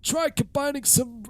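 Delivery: put-on high creaky old man; old man voice, pitched high. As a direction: play a rickety old man. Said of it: "gotta read it in the old man voice".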